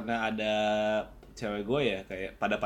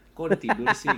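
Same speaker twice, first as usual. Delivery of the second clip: put-on whiny girlfriend; 9 semitones higher, 4 dB louder than usual. Girlfriend voiced on late call.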